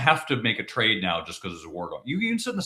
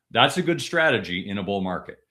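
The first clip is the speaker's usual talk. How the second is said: ordinary delivery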